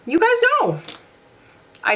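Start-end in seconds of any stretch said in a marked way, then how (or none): 0.1-0.8 "You guys know" jumped way up in a squeak; high squeaky repeat. Real, not acted. Teasing viewers in a high squeak about a repeat favorite. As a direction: squeal it in a high, playful pitch.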